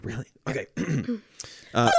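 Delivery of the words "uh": ordinary talk, nothing marked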